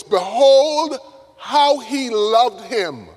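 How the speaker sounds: high, loud BEHOLD, then drawn out; awed exclamation. Acted. Onlookers at Lazarus's funeral marvel at Jesus, awed exclamation. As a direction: marvel aloud, stretching the words.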